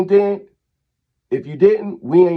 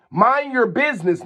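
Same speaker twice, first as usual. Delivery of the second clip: usual speaking voice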